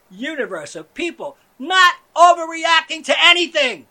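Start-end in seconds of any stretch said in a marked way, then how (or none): 1.6-3.9 loud, climbing yell; shout. Acted. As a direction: bellow the finale. Shouting closing line.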